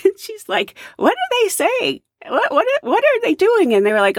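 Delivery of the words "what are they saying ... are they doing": confused. Retold or imitated. Nancy confused by the chanting.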